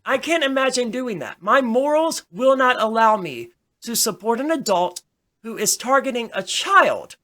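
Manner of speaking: ordinary talk, nothing marked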